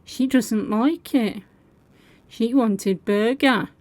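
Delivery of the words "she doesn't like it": usual speaking voice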